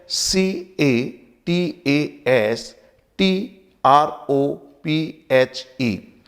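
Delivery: letter by letter, very slow; slow teacher spelling; spelled out slowly. Shown as a demonstration. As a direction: dictate each letter slowly for students. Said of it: "पहले spelling देखिए".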